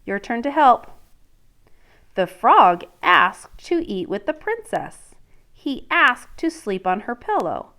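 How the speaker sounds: reading a picture book to a class; storybook read-aloud voice; story narration. Acted. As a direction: let the princess's dread come through. Teacher reads story aloud.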